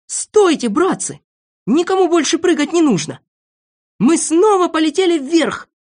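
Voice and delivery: high, loud call to halt; excited shout. A narrator's reading. Boy stops friends jumping as balloon rises, shouting. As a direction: yell urgently at the top of the voice. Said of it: "и закричал".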